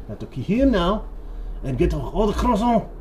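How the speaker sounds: high, hammed-up French accent; mock French accent. French sailors plotting to kill the captain, in a mock French accent. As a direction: plot the captain's murder like a cartoon Frenchman. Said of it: "French accent".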